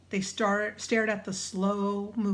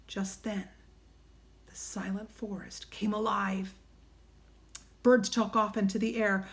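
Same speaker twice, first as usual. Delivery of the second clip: plain reading voice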